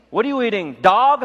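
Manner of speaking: mocking crew jab. Coworker taunting the college kid at lunch, jeering. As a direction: razz the new guy across the bus. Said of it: "Ron turns around and goes".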